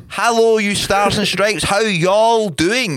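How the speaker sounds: high, loud, drawn-out hailing; hammed-up greeting. Host hailing American listeners, hammed-up loud greeting. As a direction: stretch it out like a mock showman.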